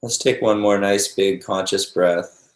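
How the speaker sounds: calm meditation guide with long, restful pauses; guided meditation. Real, not acted. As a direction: ease them gently into noticing the breath, unhurried.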